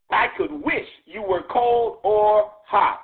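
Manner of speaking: slow, weighty reading of the verse; slow scripture quote. Acted. Preacher reading the verse slowly to the church. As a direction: let each word land slowly.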